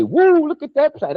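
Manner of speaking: high, amazed "woo" from a passing spaceship; imitating an amazed alien; awestruck alien onlooker. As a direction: gasp at the planet like a wowed alien tourist.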